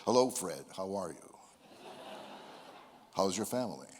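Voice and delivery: deep broadcaster greeting; announcer voice at a party. Retold or imitated. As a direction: greet a friend like a news anchor. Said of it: "I couldn't get out of that voice".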